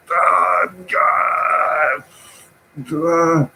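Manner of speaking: high mock cry of despair; mock anguish. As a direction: groan at the price in mock pain. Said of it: "I'm like".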